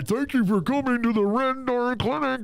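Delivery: a sunny recorded-ad voice, pitched well up; a cheesy hold recording selling the clinic; clinic hold message. Retold or imitated. Clinic hold recording cheerfully greeting callers, a mock ad voice. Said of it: "the fucking waiting music from the fucking clinic".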